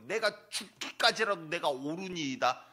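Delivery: loud, slow, defiant outburst; angry Jonah. Acted. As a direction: hurl it back at God in a sulk. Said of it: "또 화를 내요".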